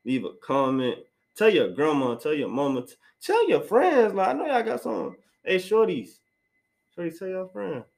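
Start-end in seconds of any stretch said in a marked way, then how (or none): 2.9-7.8 playful high voice. Pretend. Playful plug to listeners.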